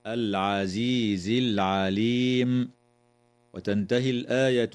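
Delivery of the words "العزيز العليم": العزيز العليم with the ل clearly sounded; distinct lam in العليم. Shown as a demonstration. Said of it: "وتظهر لام التعريف".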